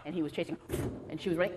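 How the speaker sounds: usual speaking voice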